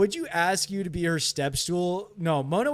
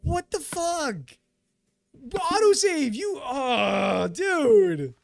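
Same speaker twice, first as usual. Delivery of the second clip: shocked outrage; 12 semitones higher, slower than usual. Real, not acted. Streamer yelling after a creeper blows up, high shocked shout. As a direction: shriek in disbelief at the explosion.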